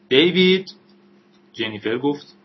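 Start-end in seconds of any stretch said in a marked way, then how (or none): 0.1-0.7 sharp, high, loud call of a name; shouted call. A narrator's reading. Someone outside calls David, shouting. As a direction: yell the name, pitched well up. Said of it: "صدایی فریاد زد".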